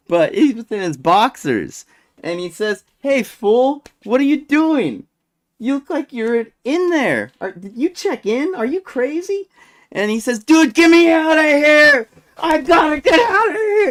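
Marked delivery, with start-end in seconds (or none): 3.0-9.5 high-pitched mock questioning of a coworker; imitated incredulous guard, pitched up. 10.4-13.9 high, loud frantic shouting, imitating a detainee; hammed-up irate plea to be let out; extremely irate. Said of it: "this guy was extremely irate".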